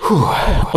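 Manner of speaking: big whew of relief; relieved exhale. A narrator's reading. Man no longer afraid of his garden, relieved. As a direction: let out a full breath. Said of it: "odetchnął pełną piersią".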